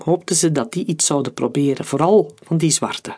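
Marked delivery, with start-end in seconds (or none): none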